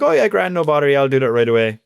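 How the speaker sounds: quick-to-agree Irish reply, high and loud at the start; high-pitched Irish lilt easing down. Retold or imitated. Irish employee agreeing to his boss's printing request, breezy Irish accent. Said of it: "I just said it in an Irish way".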